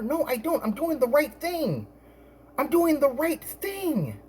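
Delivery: high, soft-volume protest, slowing on the repeat; retold indignant protest. Acted protest in retold argument.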